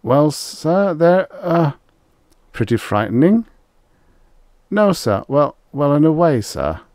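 high, loud, halting and nervous; nervous hunter. A narrator's reading. Rye answering Extroni about the beasts, nervous. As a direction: falter anxiously through the answer, pitched well up. Frightened hunter questioned by his boss.

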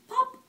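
high, loud cork pop; loud popping sound. Acted. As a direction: pop it out big and bright. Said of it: "He can sound like a cork".

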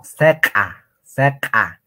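"Seca, seca" called out loud; loud drill word. Shown as a demonstration. Teacher repeating the word to pupils, loud. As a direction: hammer "seca" for the class.

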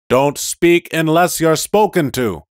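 high, loud cold order; stern rebuke. Acted. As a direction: shut him down. Police car rebukes.

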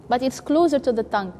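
ordinary delivery

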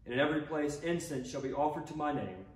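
ordinary delivery

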